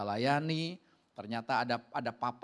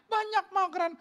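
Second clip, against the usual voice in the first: put-on proud little kid; 5 dB louder than usual. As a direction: show off to mom in a high voice. Child boasting to mother. (anak kecil pamer, suara melengking)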